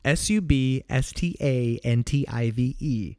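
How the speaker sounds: slow, emphatic letter-by-letter spelling; spelled out. Real, not acted. Host giving up on pronouncing the word, slow spelling.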